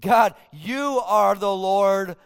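loud and slow, declaring sovereign Jehovah Adonai; proclaiming praise. Acted. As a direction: proclaim it like a herald before the king. Preacher voices David proclaiming God.

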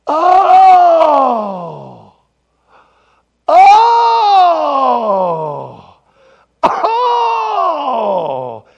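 long, high, loud falling "oh"; hammed-up wail of sudden understanding; exaggerated realization cry. Pastor finally understanding the banana peel, hammed-up wailing.

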